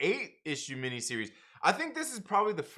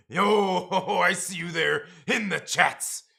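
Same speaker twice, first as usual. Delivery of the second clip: wrestler voice; 3 semitones higher, 7 dB louder, slower than usual. Pretend. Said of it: "Now say that in the wrestler voice".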